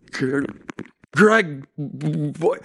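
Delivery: teeth-chattering stammer on the name; shivering stammer. Retold or imitated. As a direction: stutter it out while shaking with fever. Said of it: "shaking like this".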